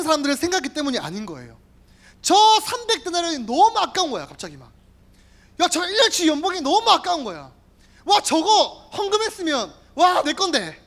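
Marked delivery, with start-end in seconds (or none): 2.2-4.3 greedy Judas. Acted. Preacher voicing greedy Judas. 5.6-10.8 greedy Judas. Acted. Preacher voicing greedy Judas.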